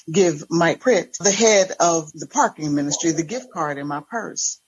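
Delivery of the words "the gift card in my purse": usual speaking voice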